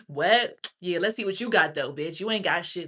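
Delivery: loud, challenging taunt; mock confrontation. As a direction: mimic someone starting a fight, loudly. Acted confrontation at grocery store.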